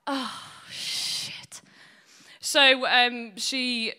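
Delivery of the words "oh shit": whispered under breath; whispered dread. Retold or imitated. Son realising his mistake, whispered dread. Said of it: "I was like".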